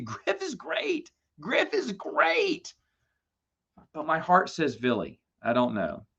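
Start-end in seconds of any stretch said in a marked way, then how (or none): 0.0-2.7 very high, drawn-out squeal; sing-song it slowly, way up in falsetto; playful falsetto admission. Real, not acted. Gushing to viewers about a favourite character, playful falsetto. Admitting fondness for Griff in falsetto.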